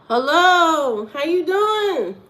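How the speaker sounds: high, loud cheerful hello; bright greeting. Real, not acted. Streamer greeting live chat, high and loud. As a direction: greet the viewers with a big lift.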